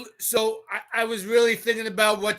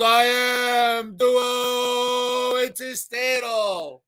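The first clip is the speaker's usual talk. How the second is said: drawn-out catchphrase shout; 3 semitones higher, much slower than usual. Acted. Host greeting a viewer with his catchphrase, stretched shout. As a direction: stretch every syllable like a ring announcer.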